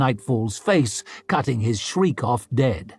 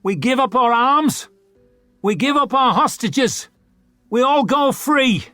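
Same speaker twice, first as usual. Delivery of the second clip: higher, declared outright; Malma's plea; 10 semitones higher, 3 dB louder than usual. Acted. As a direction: announce it to the circle. Leader proposes surrender terms.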